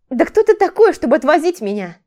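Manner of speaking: high, loud outraged question; indignant outburst. A narrator's reading. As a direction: challenge his right to do this. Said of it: "Возмутилась она".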